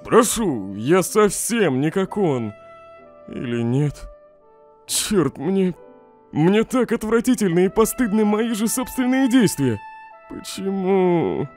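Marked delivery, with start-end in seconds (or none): 4.9-9.8 higher, strained self-loathing confession; pained, raised-pitch confession; anguished self-disgust. Acted. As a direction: let the shame push your pitch up.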